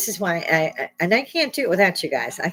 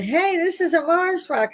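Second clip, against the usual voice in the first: much higher, chirpy mock finder's voice; mock girl finding a rock; 13 semitones higher than usual. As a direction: imitate a naive girl announcing her find, pitched way up. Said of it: "the girl that picked it up and said".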